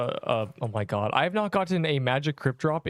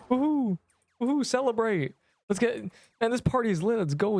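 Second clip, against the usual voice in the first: excited party cheer; 8 semitones higher than usual. Real, not acted. Streamer celebrating a party moment.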